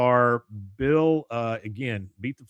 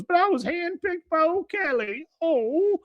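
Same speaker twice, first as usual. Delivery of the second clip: high-pitched wounded wail; hammed-up cry of protest, voice way up; Cotton; 4 dB louder than usual. Dragged away from the yacht, high mock wail. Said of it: "when he makes that, "Oh".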